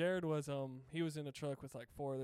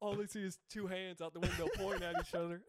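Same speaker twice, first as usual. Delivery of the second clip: higher, laughter-filled telling; cracking up; 5 semitones higher, 3 dB louder than usual. Real, not acted. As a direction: laugh your way through the punchline. Cracking up telling a funny story.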